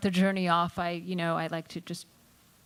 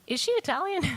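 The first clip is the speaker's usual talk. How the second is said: high-pitched retold question, incredulous; mock puzzlement; 12 semitones higher, faster than usual. Passenger asking a crew member about the woman, mock puzzled. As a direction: ask it high, playing puzzled.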